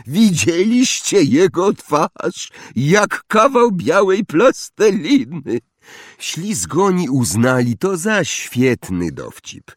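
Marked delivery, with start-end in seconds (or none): 0.1-5.6 put-on high, drawn-out jeering boy; gleeful mockery. A narrator's reading. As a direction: crow slowly at someone's expense, pitched up. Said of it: "wybuchnął głośnym śmiechem".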